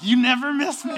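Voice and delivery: high, loud mock protest; imitated wife's complaint. Husband imitating wife's complaint, high loud mock grievance. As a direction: pitch it up, imitating an aggrieved partner. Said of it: "and then she's like".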